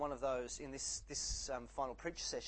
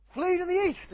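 urgent heroic shout, much higher and louder; Lone Ranger; 15 dB louder than usual. Acted. Cowboy hero warns his companion of an ambush, mock-urgent shout. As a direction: call the alarm like a hammed-up cowboy hero. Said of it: "Lone Ranger goes".

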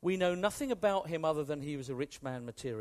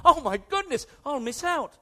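much higher and louder, hammed-up alarm; a pretend gasp of fear of missing out; mock panic; 7 semitones higher, 8 dB louder than usual.

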